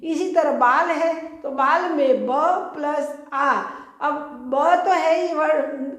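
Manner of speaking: ordinary talk, nothing marked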